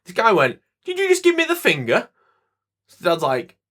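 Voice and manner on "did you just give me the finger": high, indignant mock confrontation; a retold stranger demanding an answer, mock indignant; mock aggressive challenge. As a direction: play the angry stranger squaring up. Angry man confronting them.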